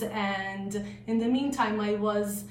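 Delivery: ordinary talk, nothing marked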